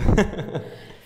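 a light, high laugh, breathy and unvoiced; bright real laugh. Real, not acted. Therapist laughing with the patient after the crack, light airy laugh. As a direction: laugh along with the patient, amused.

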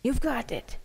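spring it on the listener after the pause; abrupt spooky gotcha; jump-scare pounce. A narrator's reading. Jump-scare climax of ghost story.

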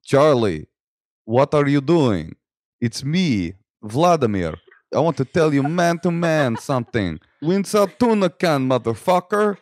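high, put-on Ukrainian man's accent. Surprising Charlie as old-school Vladimir, mock Ukrainian accent. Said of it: "like, Ukrainian".

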